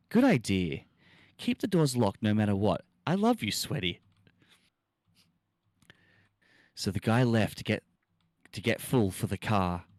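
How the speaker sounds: milk every broken sentence for laughs; dramatic story reading. Acted. Streamer reads a badly typed horror story to chat, hammed up.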